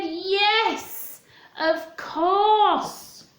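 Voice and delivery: high, bright little cub agreeing; cheerful cub. Acted. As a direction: say it like a happy little bear. Little Bear answers.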